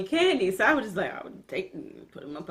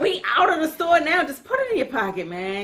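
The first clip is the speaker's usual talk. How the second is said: grandma yelling; 7 semitones higher than usual. Retold or imitated. Grandma voiced in a retold story.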